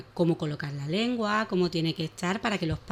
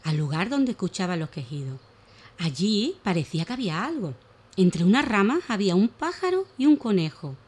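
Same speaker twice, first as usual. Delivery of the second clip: put-on lively, animated reading of a children's tale; expressive children's story voice; storytelling for children; 2 semitones higher, 3 dB louder than usual. A narrator's reading. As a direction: tell it like a bedtime story for little ones.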